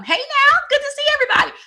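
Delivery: hammed-up greeting. Mom imagining her crew arriving, high and loud mock greeting. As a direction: ham it up like the life of the party.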